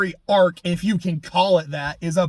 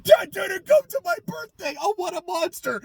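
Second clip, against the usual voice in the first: mock whiny victim; 12 semitones higher than usual. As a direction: sneer the line like a spoiled kid. Mocking the character.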